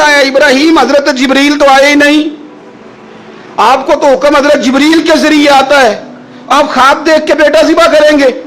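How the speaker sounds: high, coaxing devil's whisperer voice; tempting devil. Acted. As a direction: lure him off the path, voice pitched high. Satan tempts Ibrahim on road. (شیطان کی بہکاتی ہوئی اونچی آواز)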